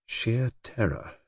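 soft, slow, reflective repetition; grave echo. A narrator's reading. Narrator recalling the look on Brenda's face, grave and hushed. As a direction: let the phrase sink in slowly, hushed.

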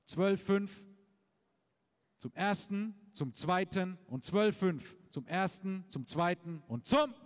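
brisk rhythmic auction bid calling; auctioneer. Real, not acted. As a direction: rattle off the bids like an auctioneer. Calls bids at horse auction.

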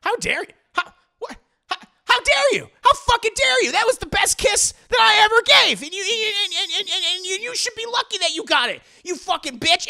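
high, loud sputtering fury; imitating a man losing his composure; hammed-up outrage. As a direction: stammer in indignant disbelief, building to a shout. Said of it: "his whole facade falls".

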